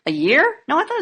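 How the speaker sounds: high, astonished question; surprised. Real, not acted. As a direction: ask it in disbelief. Surprised at the answer.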